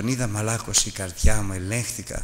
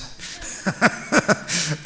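short laugh; 5 semitones higher than usual. Real, not acted. Laughs at own cowardice.

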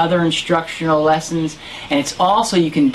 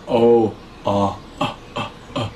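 relaxed cough demo; 6 semitones lower, 3 dB quieter than usual. Shown as a demonstration. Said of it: "my mouth is very relaxed".

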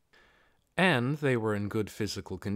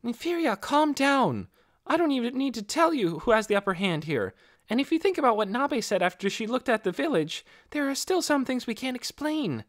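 much higher, gentle but firm voice; calm your hot-headed friend down; Ninya's voice; 12 semitones higher than usual. Acted. Ninya restrains Inferia from fighting, higher and soothing. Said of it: "said Ninya".

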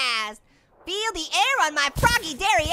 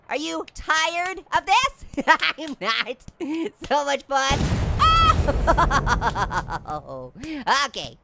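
ordinary delivery

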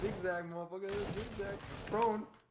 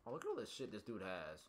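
usual speaking voice

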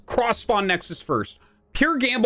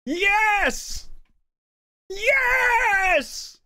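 high, long-held cry of victory; over-the-top triumphant yell; 12 semitones higher than usual. Acted. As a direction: stretch each yes into a shriek. Celebrating the score in rant.

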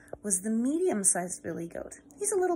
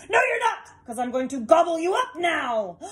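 loud, much higher shouting troll; troll threat; 7 semitones higher, 7 dB louder than usual. Acted. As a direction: yell the threat at the goat. Troll threatens medium goat.

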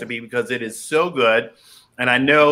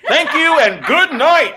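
mock farewell; 14 semitones higher, 6 dB louder than usual. Mock sign-off after tasting.

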